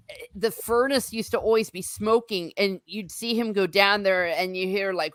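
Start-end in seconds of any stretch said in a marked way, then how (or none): none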